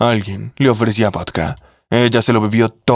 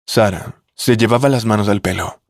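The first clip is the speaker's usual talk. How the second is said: usual speaking voice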